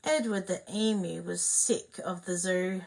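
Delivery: ordinary delivery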